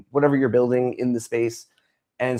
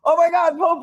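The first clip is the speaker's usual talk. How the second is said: much higher and louder gasp of recognition; mock shocked recognition; 4 dB louder than usual. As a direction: yelp it in mock disbelief. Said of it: "And I'm like".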